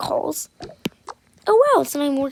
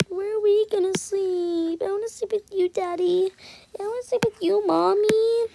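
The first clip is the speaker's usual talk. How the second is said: put-on high child pleading; higher kid voice begging parents; little kid voice; 6 semitones higher than usual. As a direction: beg like a small child. Twins ask where to sleep.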